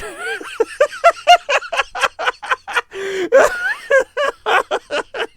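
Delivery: long, high wheezing laugh fit; hysterical laughter. Real, not acted. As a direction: crack up helplessly, loud and squealing. Laughing fit during play.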